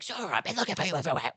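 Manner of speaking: high, fast, excited call; quick worried calling to a friend; lightly voiced Sanji searching. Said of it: "and he's like".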